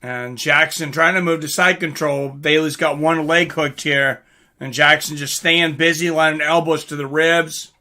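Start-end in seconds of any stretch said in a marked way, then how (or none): none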